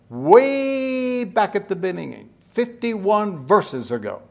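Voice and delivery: a long, high stretched WAY. Real, not acted. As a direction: stretch "Way" out high, then slide down. Exaggerates how long ago it was.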